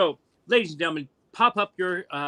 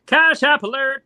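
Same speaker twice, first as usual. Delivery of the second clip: announcer voice; 11 semitones higher, 4 dB louder, slower than usual. Acted. Announcing a donation.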